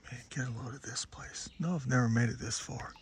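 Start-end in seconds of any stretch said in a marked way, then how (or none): 0.1-1.5 breathy unvoiced whisper. Real, not acted. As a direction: hush it, barely voiced. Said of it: "the more I have to whisper".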